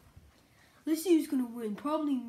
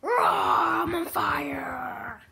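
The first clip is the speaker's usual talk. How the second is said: very slow, drawn-out growly shout; hammed-up on-fire roar; 2 semitones higher, 6 dB louder, much slower than usual. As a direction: stretch it like a hype announcer. Player catches fire in game.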